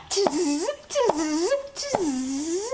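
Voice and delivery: monkey chatter. A narrator's reading. Monkeys stamp both feet back at the peddler, mocking chatter. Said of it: "the monkeys ... said".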